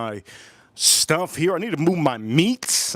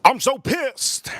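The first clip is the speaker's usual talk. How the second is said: mock anger; 9 semitones higher, much slower than usual. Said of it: "because I'm really mad".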